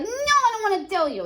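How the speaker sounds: hammed-up teenage sob; teacher playing Romeo as a crybaby son, mock crying. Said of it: "And Romeo's like".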